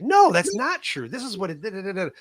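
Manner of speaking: high-pitched, insistent protest; heated objection. Retold or imitated. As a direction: argue back firmly without screaming. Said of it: "heated debates, not like we're screaming".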